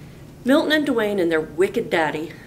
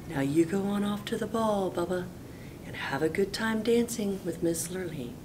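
fairy god cow; 3 semitones higher, 8 dB quieter than usual. Acted. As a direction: gently send him off.

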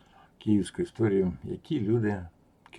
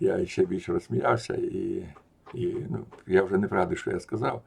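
plain reading voice

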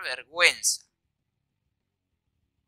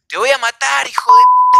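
loud, high-pitched re-enacted scream; furious yell climbing to a screech; shouted threat; 9 semitones higher, 13 dB louder than usual. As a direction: explode in a yell at someone down the street. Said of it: "le grito y le digo".

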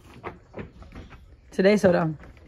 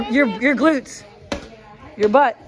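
ordinary delivery

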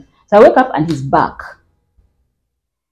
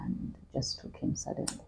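whisper; 3 semitones lower, 22 dB quieter than usual. Real, not acted. Trailing off about brother's death.

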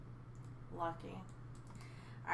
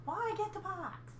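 mocking voice; 10 semitones higher than usual. Mocking the husband's words.

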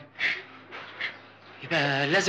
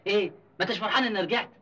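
ordinary talk

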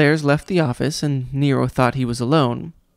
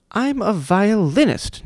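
higher, slow self-important exclamation; higher, slow pompous outcry; cried out; 7 semitones higher, 3 dB louder, slower than usual. A narrator's reading. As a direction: proclaim it grandly. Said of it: "Nero cried".

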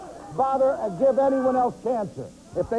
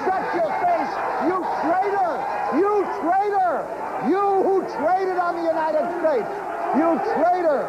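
high, angry yelling; shouting traitor at the guest; furious shout; 8 semitones higher than usual. Real, not acted. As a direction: scream him down as a traitor. Host screaming at guest.

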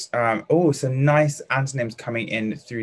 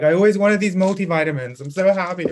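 squealed much higher and louder, over the top; hysterical joy; 7 semitones higher, 7 dB louder than usual. Shown as a demonstration. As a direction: gush wildly over a present. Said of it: "That would be being hysterical".

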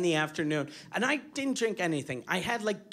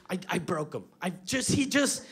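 normal talking voice

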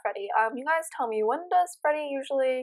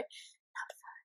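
voiceless aside, much softer; whisper; 23 dB quieter than usual. Real, not acted. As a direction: whisper it to yourself. Repeats then whispers not fun.

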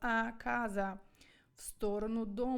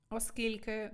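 normal talking voice

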